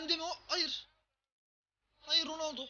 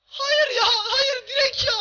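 loud, high, slow wail of no; anguished shout; 6 semitones higher, 5 dB louder, slower than usual. Real, not acted. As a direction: cry out in despair at the post. Another shot hits the post. (acı dolu bağırış)

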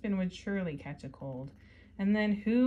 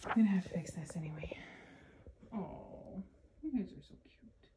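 whispering. Real, not acted. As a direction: whisper it so nobody wakes. Aside to kids during reading.

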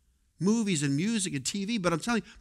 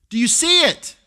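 higher and louder, pressing the crowd; loud rising challenge; 7 semitones higher, 10 dB louder than usual. Shown as a demonstration. Preacher challenging the congregation, higher and louder. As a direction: push the question up and out.

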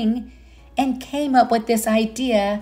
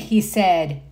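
gentle storybook narration; reading to children; 4 dB louder than usual. Acted. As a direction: read it like bedtime to little ones. Reads picture book aloud.

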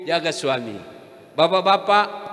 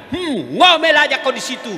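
mocking taunt; 5 semitones higher, 6 dB louder than usual. Husband taunts wife in the well.

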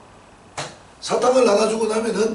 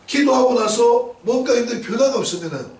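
usual speaking voice

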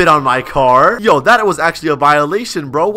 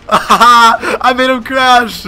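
laughing; 6 semitones higher, 6 dB louder, much slower than usual. Real, not acted. Gloating after making rival crash.

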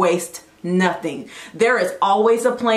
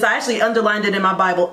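usual speaking voice

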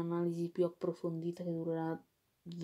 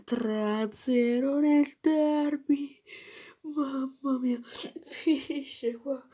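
higher, louder and slow, exulting; excited shout; 5 semitones higher, 4 dB louder than usual. Real, not acted. Fan celebrating the derby win to viewers, triumphant shout. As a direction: belt the score out in triumph.

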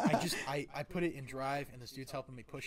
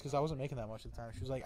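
usual speaking voice

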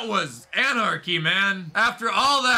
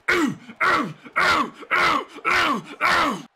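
string of short high oohs, one per blow; repeated high-pitched impact grunts; rapid-fire oohs; 8 semitones higher, slower than usual. Real, not acted. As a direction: react to each hit with a punchy ooh. Reacting to repeated blows.

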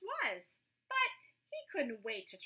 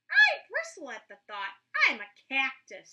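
cactus voice; 10 dB louder than usual. A narrator's reading. Cactus snapping back at Roy, louder. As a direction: bristle at the idea, loudly offended.

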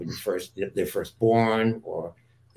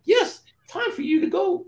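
mock Mister Hand. Guest voices Mister Hand sending Bill away, very high. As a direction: toss off the sinister line in a high cartoon voice. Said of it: "Mister Hand is like".

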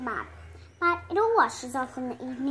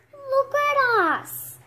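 high, slow wail of dismay; cry of dismay; 11 semitones higher, 4 dB louder, slower than usual. A narrator's reading. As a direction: cry out in alarm. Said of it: "they cry in dismay".

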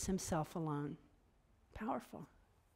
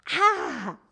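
high cry; 7 semitones higher, 7 dB louder than usual. Real, not acted. Exclamation after joke.

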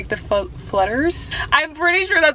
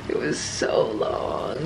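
weary groan; 11 semitones lower, 7 dB quieter, much slower than usual. Real, not acted. Complaining about long meeting.

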